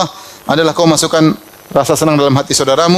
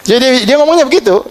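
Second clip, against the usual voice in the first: laughing; 9 semitones higher than usual. Real, not acted. Laughing recalling friend's reply.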